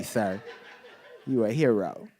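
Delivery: ordinary talk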